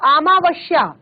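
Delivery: अमावस्या with sh, "amaavashya"; spoken mispronunciation. Shown as a demonstration. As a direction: turn the s of अमावस्या into sh. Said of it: "बोलते वक्त".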